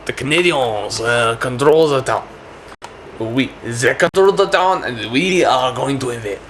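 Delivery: slow, put-on French accent. The French meeting to plan an invasion, French accent. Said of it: "My French accent, you'll know what it is".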